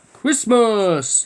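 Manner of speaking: a giant's big booming call. A narrator's reading. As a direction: boom it out like a friendly giant. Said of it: "he boomed in a voice that made my head spin".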